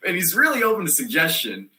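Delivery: ordinary talk, nothing marked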